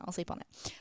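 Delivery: soft whispered echo; whispered repeat. Retold or imitated. Podcaster echoing her own indecision in a whisper. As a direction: whisper it to yourself.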